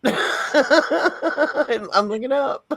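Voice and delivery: laughing, high and very slow. Real, not acted. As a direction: laugh through the words as you repeat them. Laughing repeat of looking up.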